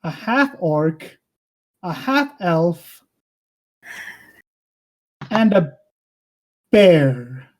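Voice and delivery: lightly voiced king, slow and deliberate; king listing suspects. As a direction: draw out the list with pointed pauses.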